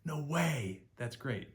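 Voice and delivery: mock amazement. Acted amazement example.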